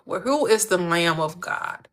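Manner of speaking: ordinary talk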